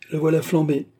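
offhand exclamation. A narrator's reading. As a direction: toss it out as a lively exclamation. Card player's callous exclamation.

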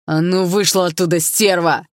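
loud furious yell across the yard; angry roar. A narrator's reading. Ex yells at the girl in the taxi, furious roar. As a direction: roar it so the whole yard hears. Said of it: "заорал он на весь двор".

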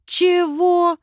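a high, loud roar of outrage. A narrator's reading. Heroine roars back at the old adviser. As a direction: bellow it in disbelief. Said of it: "взревела я".

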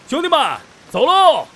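rising, loud call to move out; rallying shout. Acted. Band leader calls his brothers to set off, shouting. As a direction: rouse the men and get going.

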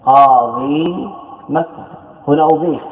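ordinary talk

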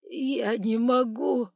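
slow, loud tearful whine; high, drawn-out wail; near tears. A narrator's reading. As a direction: plead as if about to cry. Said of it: "Ростовщик уже чуть не плакал".

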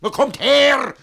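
furious shout. Acted. Master shouting at his dawdling servants, furious yell. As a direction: bellow it across the house.

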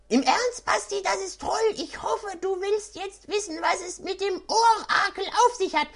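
high, eager little gnome voice; funny high voice. Acted. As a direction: squeak it out like an excited gnome. Engewuck character voice, excited.

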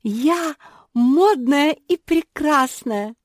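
high, loud, drawn-out rapture; delighted. A narrator's reading. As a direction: crow it slowly in sheer delight. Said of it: "пришла в восторг Жози". (восторженно, громко, нараспев)

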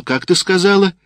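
high-pitched, incredulous question; astonished. A narrator's reading. Sergeant baffled by an unknown place name, astonished. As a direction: ask again in disbelief. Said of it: "пришла очередь удивляться сержанту".

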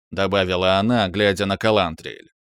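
ordinary delivery, nothing marked

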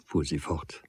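plain reading voice

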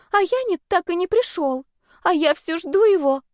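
put-on high, plaintive girlish voice; lovesick girl. A narrator's reading. Lovesick girl about absent boyfriend, plaintive and high. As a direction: confide it in a small high voice.

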